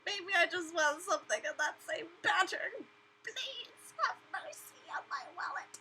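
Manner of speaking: mock-tearful begging for mercy on the wallet; whiny plea. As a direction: beg like a broke shopper pleading with a brand. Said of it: "I'm like".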